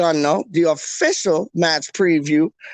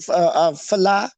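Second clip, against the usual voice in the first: ordinary delivery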